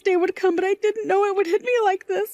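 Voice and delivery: choked up with happiness; happy tears. Acted. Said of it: "I'm crying right now".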